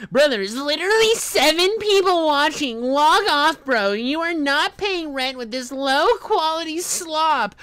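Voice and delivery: very high, loud jeering read; mocking troll voice. Streamer reading a troll's chat message, shrieky mock voice. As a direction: shout it in a high-pitched parody of a heckler.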